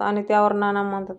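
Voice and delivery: ordinary delivery, nothing marked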